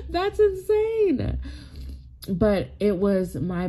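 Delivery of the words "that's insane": high, slow, laughing disbelief; mock gasp of disbelief with a laugh; shocked listener. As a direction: react as a stunned listener. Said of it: "and you would be like".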